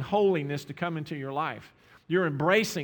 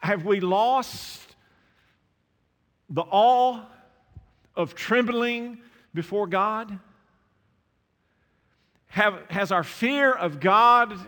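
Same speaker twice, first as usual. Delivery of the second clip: slow, high, louder pleading questions; slow preaching; 4 semitones higher, 6 dB louder, slower than usual. Acted. Preacher asking the church if awe is lost, slow. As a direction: lean in and plead slowly.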